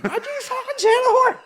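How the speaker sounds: very high mock battle cry through a laugh; cousin going Wolverine. Cousin Grania squaring up, mock battle cry. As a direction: squeal it like a cartoon fighter unleashing claws.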